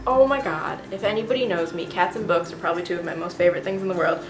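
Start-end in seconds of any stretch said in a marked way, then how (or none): none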